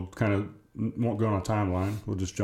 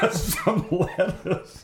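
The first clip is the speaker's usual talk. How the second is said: laughing; 9 semitones higher, much slower than usual. Real, not acted. Mocking repeat of odd ingredient.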